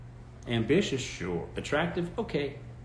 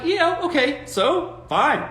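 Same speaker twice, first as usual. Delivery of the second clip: dismissive rich guy; 13 semitones higher, 4 dB louder, much slower than usual. Retold or imitated. Imitating unbothered rich man.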